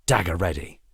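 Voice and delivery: ordinary delivery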